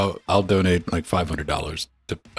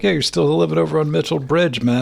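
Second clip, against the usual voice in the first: higher-pitched imitation of a chummy acquaintance; chatty acquaintance; 6 semitones higher than usual. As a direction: ramble on like an old pal. Voicing an overfamiliar acquaintance.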